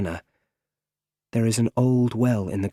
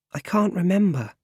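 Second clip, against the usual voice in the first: lightly voiced child, higher pitch; little boy; 9 semitones higher than usual. A narrator's reading. Boy in bed answering the butler, childlike and high. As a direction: answer in a small boy's raised voice.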